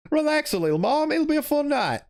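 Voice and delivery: put-on sing-song teen girl brushing off her mother; high girly voice. Daughter to her mom at the party, high girly voice.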